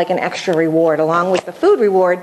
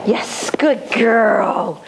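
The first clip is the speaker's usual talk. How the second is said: drawn-out praise; much slower than usual. Shown as a demonstration. Praising puppy after a sit.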